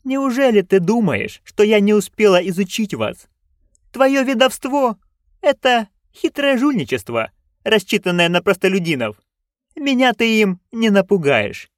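high-pitched, scornful noblewoman; imperious duchess, defiant. Acted. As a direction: defy the witch as a haughty duchess. (надменная герцогиня, с вызовом)

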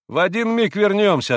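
high, loud, brisk promise; hearty reply. A narrator's reading. Mitya promising Grushenka a quick return, hearty call. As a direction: toss the answer back confidently. Mitya cheerfully reassures, leaving.

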